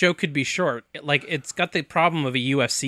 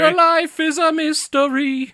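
announcer voice; 13 semitones higher, 5 dB louder, slower than usual. Acted. Mock announcer intro for fighter.

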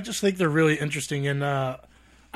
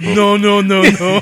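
1 loud protest; 6 semitones higher, 12 dB louder, slower than usual. Retold or imitated. Reacting to the dream.

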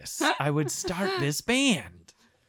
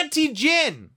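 announcer product name; 8 semitones higher than usual. Pretend. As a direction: announce the brand like a jingle.

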